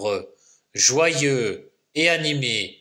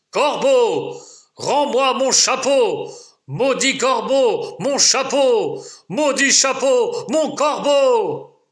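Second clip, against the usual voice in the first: lumberjack bursting out; 10 semitones higher than usual. Acted. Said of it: "le bûcheron éclate".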